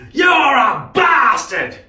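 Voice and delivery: very high, loud, slow shout; drunk old man's shout. Retold or imitated. Drunk old man on the footpath yelling at the driver, drawn-out shout. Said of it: "He's probably seventy".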